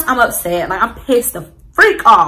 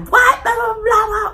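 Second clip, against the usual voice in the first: mock scolding; 13 semitones higher than usual. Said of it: "she's like".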